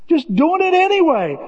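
"Just doing it anyway" lifted high and stressed; emphatic high. Shown as a demonstration. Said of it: "repeated with high emphasis".

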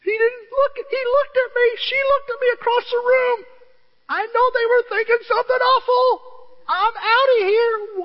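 very high, hammed-up tearful wail; offended mock crying. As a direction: pretend to sob out a petty grievance. Mimics an offended churchgoer crying.

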